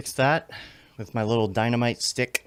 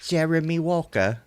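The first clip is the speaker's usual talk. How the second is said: mock British roll-call; British accent; 2 semitones higher than usual. Streamer riffing on names from the chat, playful British accent. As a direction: savour each name in a put-on British accent. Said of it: "British accent".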